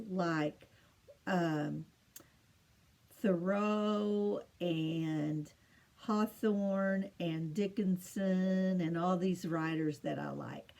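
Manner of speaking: ordinary talk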